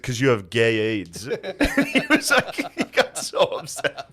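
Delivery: helpless laughter breaking into the words; laughing. Real, not acted. Host telling how dad got upset, laughing. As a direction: tell it through a laughing fit. Laughing through a family story.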